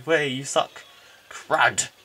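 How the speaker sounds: ordinary talk, nothing marked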